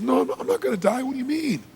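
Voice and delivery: high-pitched, incredulous protest, acted; bewildered father. Father answers his crying daughter, acted bewilderment. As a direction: brush it off in baffled disbelief.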